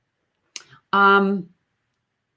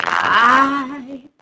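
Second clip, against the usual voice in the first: fry slide; 4 semitones higher, 4 dB louder than usual. Shown as a demonstration. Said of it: "we've got the fry slide".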